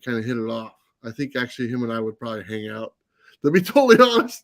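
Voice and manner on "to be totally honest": high, loud laugh breaking through. Real, not acted. Admitting he could be friends with Vincent, laughing. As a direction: laugh at your own confession.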